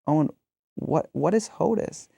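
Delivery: half laughing, puzzled parent asks what it means; baffled question. Retold or imitated. Parents questioning son about hodus, amused disbelief. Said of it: "we said".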